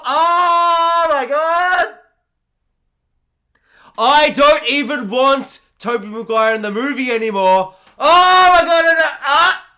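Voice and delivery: very high, loud ecstatic shrieks; real excited scream. Real, not acted. Hearing Emma Stone might return, real delighted scream. Overexcited over Spider-Gwen.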